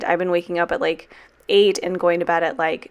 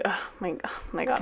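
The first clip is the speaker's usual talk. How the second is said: softer, breathless whispered "oh my God"; teary whisper; 11 dB quieter than usual. Real, not acted. Getting emotional about her dog, whispering. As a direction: whisper it, choked up. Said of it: "I'm literally tearing up".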